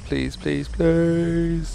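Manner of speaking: beg, stretching the last one high; pleading. Real, not acted. Begging before crystal opens.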